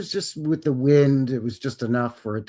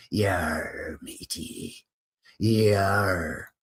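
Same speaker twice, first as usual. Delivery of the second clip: mock pirate; 6 semitones lower, 3 dB quieter, much slower than usual. Pirate joke on piracy.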